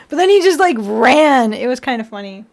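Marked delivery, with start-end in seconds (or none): none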